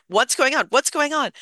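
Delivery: put-on retelling her teen self pleading for news, voice shooting up; frantic. Teenager on the phone demanding mom explain, frantic and loud. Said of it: "brief, frantic exchange".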